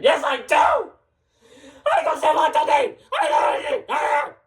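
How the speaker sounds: high, frantic shouted rant; escalating squabble at high pitch; tantrum shouting. Acted. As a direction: yell back in a high voice. Acted quarrel, tantrum.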